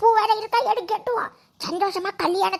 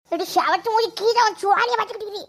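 ordinary delivery

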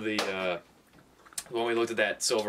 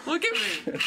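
very high squeaky mock voice; 13 semitones higher, 5 dB louder, slower than usual. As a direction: parody his voice, pitched way up. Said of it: "I don't think I talk like that".

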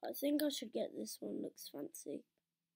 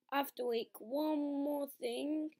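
ordinary talk

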